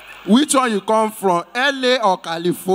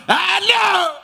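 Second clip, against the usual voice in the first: shouted hello; 11 semitones higher than usual. Real, not acted. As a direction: hail a passer-by across the street. Emcee calling a passer-by.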